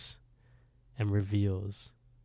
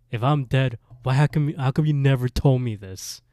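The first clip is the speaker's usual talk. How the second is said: louder, higher retold angry demand; pissed; 4 semitones higher, 8 dB louder than usual. Retelling dead Johnny confronting Erica, raised and angry. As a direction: demand an explanation, acting indignant. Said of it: "he gets pissed".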